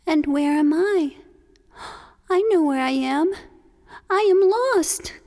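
high, rising panic in a small turtle voice; a little creature realizing he is lost. Acted.

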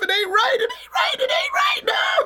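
rising into a high, shrieking wail; outraged wail. Acted. Possum wailing to Rabbit that Snake lied, shrieking. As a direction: cry out at the unfairness.

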